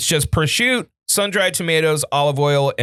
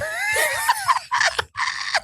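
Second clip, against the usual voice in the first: squealing laugh; high squeal of delight; high shriek; 4 dB quieter than usual. Retold or imitated. Imitating Lady Jams sliding.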